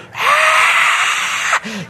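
high far-off yell; faraway mock battle scream; distant war cry. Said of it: "you can just hear".

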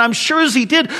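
ordinary talk

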